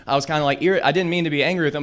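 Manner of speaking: ordinary delivery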